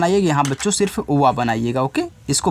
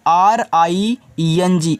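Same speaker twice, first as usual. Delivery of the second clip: slow spelling out; much slower than usual. Shown as a demonstration. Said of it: "इसको ring पढ़ना है".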